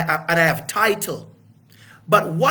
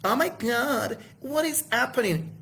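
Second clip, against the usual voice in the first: softer, higher, faked surprise; mock shocked bystander; 4 semitones higher, 5 dB quieter than usual. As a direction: play a bystander pretending to be shocked. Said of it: "behave as if".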